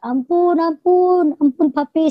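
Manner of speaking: high, drawn-out mock yell; retold cry for mercy. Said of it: "Saya berteriak".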